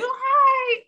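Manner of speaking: high-pitched hi to the bulldog on her lap. Retold or imitated. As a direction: squeal hello up high like meeting a puppy. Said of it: "I made, like, a high-pitched voice".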